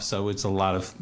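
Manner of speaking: ordinary talk, nothing marked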